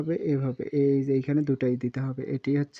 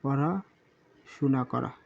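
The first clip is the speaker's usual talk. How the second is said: slow dictation; much slower than usual. Shown as a demonstration. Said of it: "teacher dictating meaning slowly".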